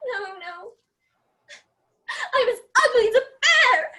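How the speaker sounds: tearful, breaking into a sob-laugh; crying. Acted. Helena despairing over her looks, crying. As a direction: cry it out in self-pity.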